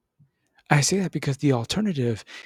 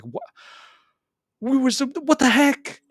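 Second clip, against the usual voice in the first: high, indignant woman's voice; the dream wife, super pissed; hammed-up furious girlfriend; mock-angry woman; 12 semitones higher, 3 dB louder than usual. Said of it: "And she's just like".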